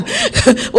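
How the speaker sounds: a quick burst of laughter; short high laugh. Real, not acted. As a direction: laugh at the memory. Laughs at own story.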